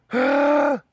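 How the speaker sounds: loud, high gasp; big sudden intake of delight; excited gasp. Real, not acted. Owner greets the pet who noticed them home, excited gasp.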